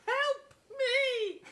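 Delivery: hammed-up plea. Dying witness pleads with the oblivious inspector. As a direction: beg for help over the top. Mock death cry.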